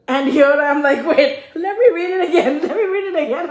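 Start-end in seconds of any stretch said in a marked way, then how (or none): 1.0-3.5 high, laughing disbelief; laughing as she rereads in shock. Real, not acted. Rereading the customer's email, laughing in disbelief.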